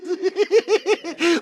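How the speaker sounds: high, quick manic giggling; gleeful giggle. Acted. Villain delighted at mayhem, high giggle.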